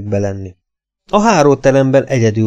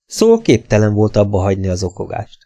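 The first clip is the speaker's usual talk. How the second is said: ordinary delivery, nothing marked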